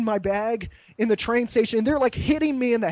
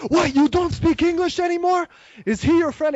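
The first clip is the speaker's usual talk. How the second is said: raised, louder mock interrogator; incredulous police officer; 9 semitones higher, 4 dB louder than usual. Policeman questioning a tourist who feigns no English, incredulous. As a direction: act out an officer's higher-pitched disbelief. Police disbelieving, retold and acted.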